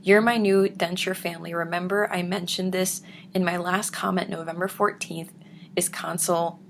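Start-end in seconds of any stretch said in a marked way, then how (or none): none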